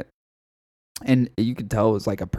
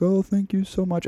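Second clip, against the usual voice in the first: put-on higher, thankful old man's voice; grateful old man; 9 semitones higher than usual. Old man thanking his defender, higher grateful voice. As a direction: lift it up high in frail gratitude. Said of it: "he's like".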